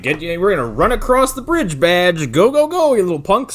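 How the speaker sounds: loud, high hurrying shouts; urgent mock command. Scoutmaster driving kids across the bridge, urgent mock shouting. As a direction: hustle them along, hammed-up and loud.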